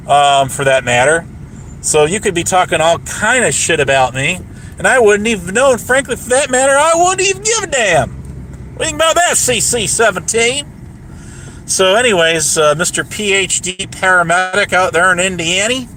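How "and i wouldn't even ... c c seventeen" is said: taunting. Real, not acted. Taunting the troll directly.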